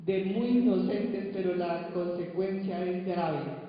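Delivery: loud, solemn reading of the verses; scripture reading. Acted. Preacher reading Revelation to the church, solemn and loud. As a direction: proclaim the scripture to the congregation.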